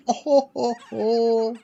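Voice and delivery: loud high mock guffaw; ho-ho it with glee. A narrator's reading. The trucks guffawing at Diesel's gossip. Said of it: "guffared the trucks".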